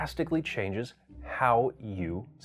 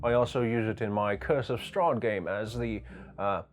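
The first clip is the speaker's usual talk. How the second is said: jaw-forward lockjaw upper-crust tone; imperious lockjaw. Shown as a demonstration. Someone used to being taken seriously, imperious lockjaw. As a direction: push the jaw out and expect obedience. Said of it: "sort of imperious tone".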